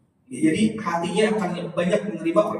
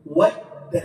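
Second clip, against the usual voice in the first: ordinary talk, nothing marked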